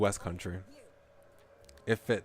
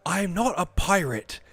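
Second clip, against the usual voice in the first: indignant West Country ship captain, louder; West Country accent; 9 semitones higher, 7 dB louder, slower than usual. Acted. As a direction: West Country captain setting the record straight. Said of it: "Okay, I'm gonna try my best".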